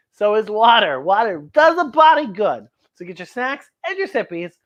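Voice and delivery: usual speaking voice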